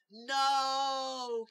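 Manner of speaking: long, high, full shout; drawn-out loud cry; yelling. Acted. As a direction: yell it out and hold it. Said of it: "Carrie yelled".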